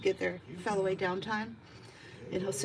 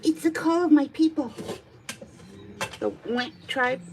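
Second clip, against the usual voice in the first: louder, playful proclamation for a cat; mock-proud cat reply; lightly voiced cat; 4 semitones higher, 10 dB louder than usual. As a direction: announce it as the cat, lightly put on. Voices the cat answering her question.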